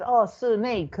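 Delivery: "snake" said as "sunake", with an added vowel, voice lifted; mispronounced snake. Shown as a demonstration. Learner saying "snake" as "sunake", lightly imitated. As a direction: mimic the student's "sunake" brightly. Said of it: "Like if they say".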